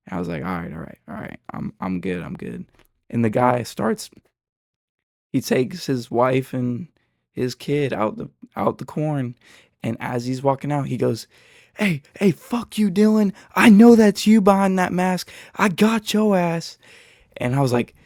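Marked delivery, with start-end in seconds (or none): 0.5-2.6 backing down. Retold or imitated. Backing down, calming himself. 11.8-16.8 loud, higher taunting yell; husband yelling at him on the way out, loud and high; parting shout. Retold or imitated. Husband yelling while leaving.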